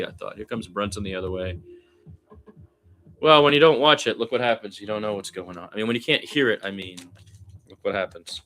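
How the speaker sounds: ordinary talk, nothing marked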